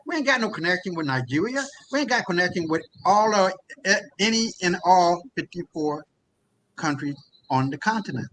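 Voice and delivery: ordinary talk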